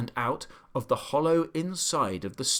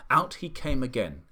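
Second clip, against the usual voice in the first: ordinary delivery, nothing marked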